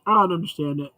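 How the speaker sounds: normal talking voice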